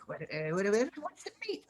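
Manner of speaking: high, stammering creature voice; goblin-like orc. Acted. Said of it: "that one goblin that was like".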